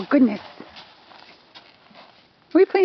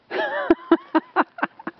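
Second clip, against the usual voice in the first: high giggle; 3 dB quieter than usual. Real, not acted. Laughing at horse.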